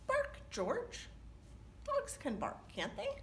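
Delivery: high, coaxing mother voice; a patient mum urging her puppy; mother coaxing her dog to bark; mother's voice. Acted.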